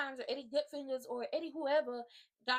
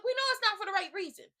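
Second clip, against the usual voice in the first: loud, higher exasperated yell; 8 semitones higher, 7 dB louder than usual. Real, not acted. Telling viewers his proposal is wrong, yelling. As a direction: yell it out in one raised burst. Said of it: "I don't mean to yell at you".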